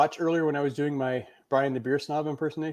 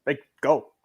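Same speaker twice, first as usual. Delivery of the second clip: dismissive go; much slower than usual. Retold or imitated. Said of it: "are just like".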